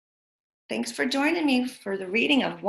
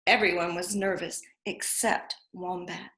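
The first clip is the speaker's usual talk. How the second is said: plain reading voice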